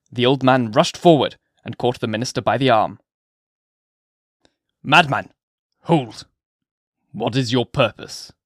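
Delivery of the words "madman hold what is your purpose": plain reading voice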